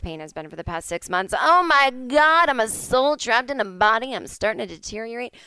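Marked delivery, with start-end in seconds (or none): 1.4-4.1 slow, loud, theatrical wail; dramatic lament. Real, not acted. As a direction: ham up the despair. Dramatic lament about her body.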